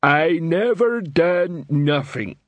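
slow, high-pitched troll drawl; sullen troll protest. A narrator's reading. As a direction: drag each word out like a dim troll. Troll suspect protests his innocence.

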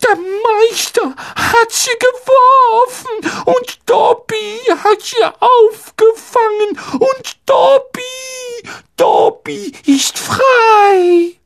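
very high, slow squeaky elf; drawn-out wonder at being freed; ungläubiger Hauself. A narrator's reading. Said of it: "sagte Dobby ungläubig". (staunende, sehr hohe Elfenstimme)

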